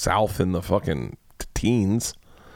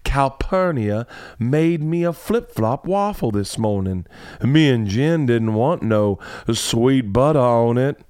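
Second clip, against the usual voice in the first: imitating old Southern accent; 3 semitones higher, slower than usual. Mimicking Georgia's ridiculous Scout reading, mock old Southern accent. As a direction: drag the words out like a folksy Southern narrator. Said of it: "old Southern accent".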